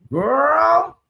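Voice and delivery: high, rising note of disbelief; can't-believe-it exclamation; incredulous. Real, not acted. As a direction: let it climb high in disbelief. Disbelief at till death do us part.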